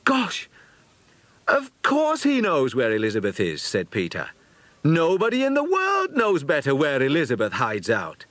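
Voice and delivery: put-on high, excited boyish voice; a lad struck by the answer; sudden realisation. A narrator's reading. Peter realising Tom knows where Elizabeth hides, excited.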